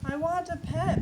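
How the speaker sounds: high, loud screaming demand; toddler screaming. Acted. Little sister screaming at aunt for a pet, loud shriek. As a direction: shriek it like a two-year-old wanting a pet. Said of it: "my two-year-old sister Lily screamed".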